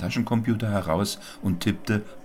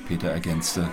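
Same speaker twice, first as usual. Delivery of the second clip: ordinary delivery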